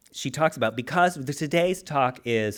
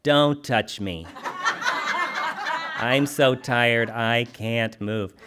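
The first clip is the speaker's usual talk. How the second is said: slow, weary grumble of exhaustion; mock-exhausted partner pushing the other away; worn-out spouse; 3 semitones lower, much slower than usual. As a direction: drag it out like you're too tired to move. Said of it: "you're like".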